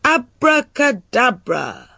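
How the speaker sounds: high, drawn-out magic word; theatrical conjurer's shout. A narrator's reading. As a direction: cast the spell with a showy cry. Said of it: "and shouted".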